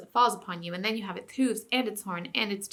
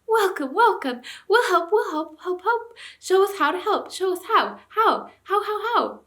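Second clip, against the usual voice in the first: much higher, loud, excited dog voices; eager talking dogs; 11 semitones higher, 6 dB louder than usual. A narrator's reading. Talking dogs greeting the king, high excited yelps. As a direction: bounce with joy, eager to help. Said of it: "barking joyously".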